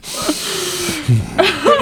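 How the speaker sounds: a shrill burst of laughter, way up high; high squealing laugh. Real, not acted. Host recalling the scene, breaks into a high squealing laugh. Recalling teammate doing yoga at bar.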